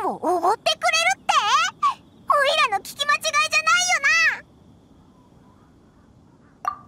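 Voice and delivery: high, bouncy sidekick voice, hungry and thrilled; a small companion who can't wait to eat; eager little companion. Acted. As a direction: chirp it out like a greedy little mascot. Paimon-like companion voice.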